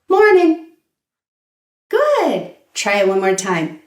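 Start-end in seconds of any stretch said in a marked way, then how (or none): 0.1-0.7 "Morning" with no G, easygoing; a casual "Morning" dropping the G; relaxed greeting. Shown as a demonstration. Teacher leading students in the informal "Morning". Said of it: "Now let's try informal".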